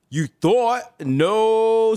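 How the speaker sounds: high, loud, drawn-out no; mocking correction. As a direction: gleefully shoot down the silly plan. Mocking own confident plan.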